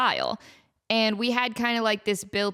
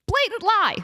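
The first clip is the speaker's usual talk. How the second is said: mock outrage; 7 semitones higher than usual. Real, not acted.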